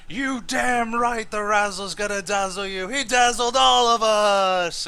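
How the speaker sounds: high, loud hyped announcer. Acted. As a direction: shout it to the crowd. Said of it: "the announcer looks to you and he says".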